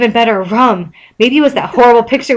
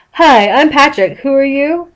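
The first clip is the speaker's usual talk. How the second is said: Patrick; 6 semitones higher, 3 dB louder than usual. A narrator's reading. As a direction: greet him brightly, utterly oblivious. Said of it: "Patrick stopped jumping and looked at Jack".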